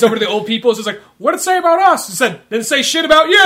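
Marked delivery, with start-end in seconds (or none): 1.2-2.1 put-on old person voice. Old couple asking. 2.5-3.4 blunt retort. Retold or imitated. Denzel's blunt answer.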